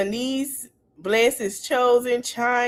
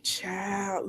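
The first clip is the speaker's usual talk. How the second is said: whisper; 4 semitones lower, 11 dB quieter than usual. Real, not acted. Aside about the girl on stream.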